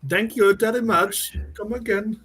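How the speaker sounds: lightly put-on Irish accent; a hint of Irish lilt, slowly. Shown as a demonstration. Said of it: "did you like the Irish?".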